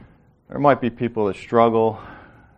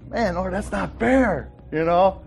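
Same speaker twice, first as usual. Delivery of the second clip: resentful complaint; 8 semitones higher than usual. Retold or imitated. Voicing a resentful believer.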